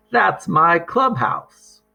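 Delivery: lightly voiced girl claiming her box; insistent girl. A narrator's reading. Christina insists to mother.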